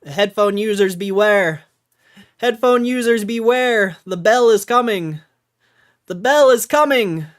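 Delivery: loud hyped warning; announcer hype. Pretend. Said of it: "you're gonna get the bell".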